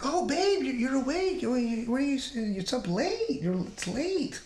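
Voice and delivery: fake-innocent surprise, stalling for time; caught off guard. Husband caught sneaking in by his wife, startled stammer.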